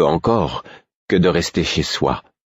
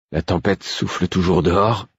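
usual speaking voice

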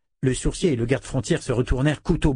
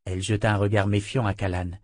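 ordinary delivery, nothing marked

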